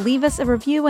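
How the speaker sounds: ordinary delivery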